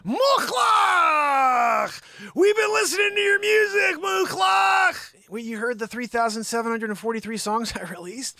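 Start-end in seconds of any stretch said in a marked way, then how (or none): 0.0-5.3 much higher, loud cheering shout; hammed-up fan worship yell; mock fan shouting. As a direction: scream the name like an adoring fan. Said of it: "someone was like".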